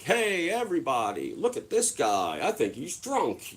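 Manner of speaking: mock him to the crowd; imitating the mascot. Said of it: "He's like".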